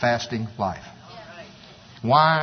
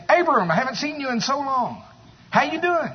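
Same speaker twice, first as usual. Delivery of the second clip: neighbor's greeting; 10 semitones higher than usual. Retold or imitated. Neighbor greets Abram after years.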